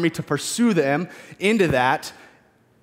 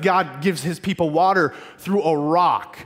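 ordinary talk, nothing marked

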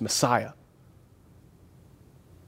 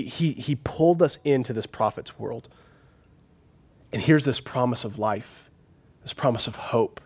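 usual speaking voice

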